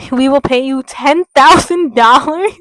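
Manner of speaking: loud, hammed-up offer with rising end; big-money offer. Blood bank doctor tempting Jane on the phone, loud and hammed-up. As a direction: announce the huge sum loudly, in a put-on voice. Caller reveals a huge payment offer.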